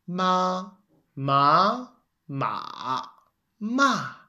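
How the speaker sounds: "ma, ma, ma, ma" said in the four tones: high level, rising, dipping, falling; four Mandarin tones. Shown as a demonstration. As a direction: step "ma" through the first, second, third and fourth tone. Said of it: "Mandarin Chinese has four tones?".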